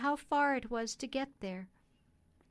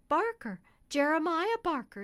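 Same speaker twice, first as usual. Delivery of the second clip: high, loud delighted exclamation; surprised recognition; 6 semitones higher, 7 dB louder than usual. Acted. Lady recognising the cabman, high and loudly surprised. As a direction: hail an old friend in surprise.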